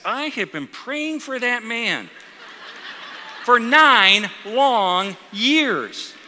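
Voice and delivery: "nine long years" loud and hugely elongated; drawn-out emphasis. Retold or imitated. Sister tells the pastor of long praying, "nine long years" drawn out. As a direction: stretch "nine long years" very slowly, word by word.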